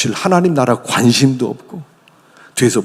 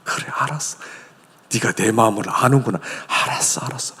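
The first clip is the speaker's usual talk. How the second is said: tender father; 3 semitones lower, 6 dB quieter than usual. Retold or imitated. Father tenderly forgiving son.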